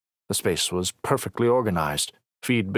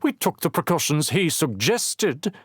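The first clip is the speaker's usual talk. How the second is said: sneering; 9 semitones higher than usual. Acted. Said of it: "she sneered".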